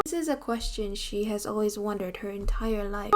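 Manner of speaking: hushed unvoiced aside; whisper. Whispering an aside to viewers about her lifelong question. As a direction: lean in and whisper it.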